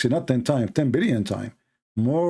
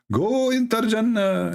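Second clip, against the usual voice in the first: slow, drawn-out, higher mock-divine command; mock solemn command; 8 semitones higher, slower than usual. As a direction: hams up a grand voice of God.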